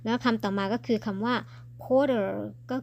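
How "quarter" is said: "quarter" said loud and higher as "korter"; demonstrated "quarter" without the w glide; no kw cluster. Shown as a demonstration. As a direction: say "quarter" starting with plain k, no w. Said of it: "เราออกเป็น quarter ไปเลย".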